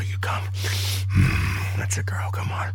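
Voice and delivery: soft unvoiced whisper of encouragement. Whispering her partner through the climax. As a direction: hush it right into her ear.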